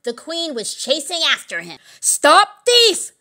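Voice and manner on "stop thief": high, loud alarm call; shouting. A narrator's reading. Someone raising the alarm behind her, high shout. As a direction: shout after a fleeing thief. Queen chasing the knave.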